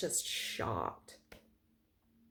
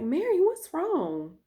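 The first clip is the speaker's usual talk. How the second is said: concerned principal; 7 semitones higher, 3 dB louder, slower than usual. Retold or imitated. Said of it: "she's like".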